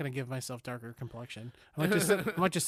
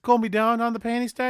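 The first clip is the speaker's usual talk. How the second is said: mock repeated catchphrase; 7 semitones higher, 7 dB louder than usual. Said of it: "And then he goes".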